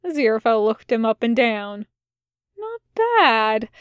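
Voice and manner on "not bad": higher, admiring "not bad"; impressed with a hint of envy; appreciative. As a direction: size him up and approve. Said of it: "he said appreciatively".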